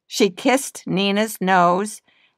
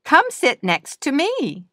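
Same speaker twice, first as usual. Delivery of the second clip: friendly hippo; 8 semitones higher than usual. A narrator's reading. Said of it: "Hannah Hippo said".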